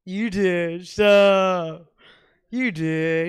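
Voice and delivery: loud playful snap; loud retort. Acted. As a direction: fire it back loudly at the crowd. Mock retort to audience.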